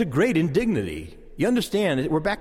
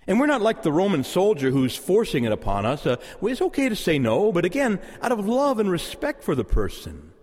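ordinary talk, nothing marked

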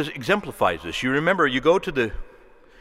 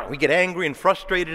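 normal talking voice

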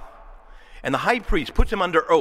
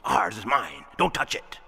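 possessive; 6 semitones lower than usual. Retold or imitated. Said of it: "that kind of a thing".

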